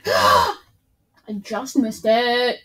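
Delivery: loud, slow frustrated wail. Real, not acted. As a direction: drag it out, annoyed at the miss. Gasp then lament over near miss.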